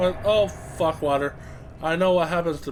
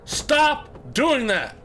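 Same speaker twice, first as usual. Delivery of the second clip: drawn-out annoyed plea; 8 semitones higher, 3 dB louder, much slower than usual. Real, not acted. Annoyed at screaming.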